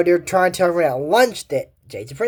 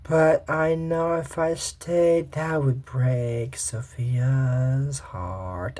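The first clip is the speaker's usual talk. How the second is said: much softer and very slow, wistful; a little sad; 5 dB quieter, much slower than usual. Retold or imitated. Jade sadly saying goodbye to her old school, soft and very slow. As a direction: drag each word out slowly, sadly. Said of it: "gets a little sad and goes".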